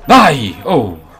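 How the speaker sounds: high, loud cry, falling away; exasperated shout. Real, not acted. Gamer caught in a firefight, loud outburst. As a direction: burst out mid-fight.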